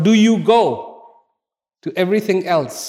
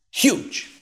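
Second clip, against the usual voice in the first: "Huge." breathed out, unvoiced and wide-eyed; hushed awe on "Huge."; breathy emphatic whisper; 9 semitones higher than usual. Repeating the lion's size for effect.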